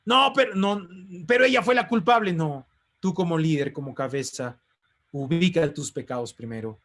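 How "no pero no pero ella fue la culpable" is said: quick, higher, loud defensive excuse; husband mimicked dodging blame, fast and raised; mock protest. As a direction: blame her, hurriedly and indignantly.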